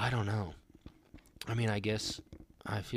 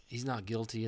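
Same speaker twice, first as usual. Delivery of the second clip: usual speaking voice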